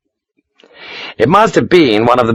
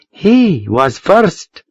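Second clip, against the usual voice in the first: Josefina; 10 semitones higher, much slower than usual. A narrator's reading. Josefina refusing Sharp.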